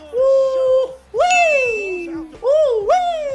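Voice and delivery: high, loud "woo" and "wee"; celebratory whoops. Real, not acted. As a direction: alternate high whoops like a siren. (matinis at malakas na hiyaw)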